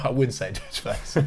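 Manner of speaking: laughing through the words. Real, not acted. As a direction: chuckle as you say it. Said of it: "laughs while speaking".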